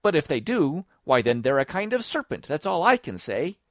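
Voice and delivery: high, loud bird voice; emphatic high-pitched retort; argumentative pigeon. A narrator's reading. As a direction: lay down the verdict as a stubborn bird. Pigeon argues back.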